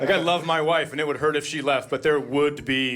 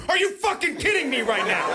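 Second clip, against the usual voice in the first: much higher exasperated outburst; mock exasperation; 10 semitones higher than usual. Comic parent losing patience with kids, much higher. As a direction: blurt it out at the end of your rope.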